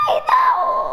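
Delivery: very high wail of dismay; dismayed shriek. Real, not acted. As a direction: squeal in alarm. Another setback in the game.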